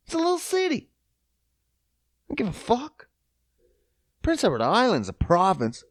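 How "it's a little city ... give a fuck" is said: mocking dismissive. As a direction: brush it off mockingly.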